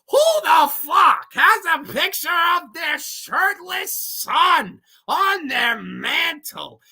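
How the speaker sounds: high, loud, drawn-out disbelieving yell; outraged shout. Real, not acted. Ranting at a weird movie scene.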